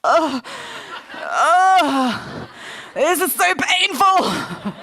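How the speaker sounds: imitating a groaning, wincing reader, laughing through it; playful mock agony of an editor; mock pained reader. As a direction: ham up a reader's pain at typos. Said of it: "she was like".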